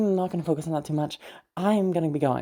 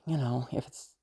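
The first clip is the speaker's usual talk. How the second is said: trailing whisper; 2 semitones lower, 6 dB quieter than usual. Acted. To the listener, hesitating before a touchy question, whispered. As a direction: whisper it, trailing off mid-sentence. Character trails off mid-thought.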